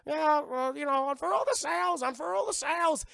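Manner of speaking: very high, squawking parrot voice; talking parrot. Retold or imitated. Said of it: "trained the parrot to talk for him".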